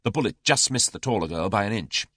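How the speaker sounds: plain reading voice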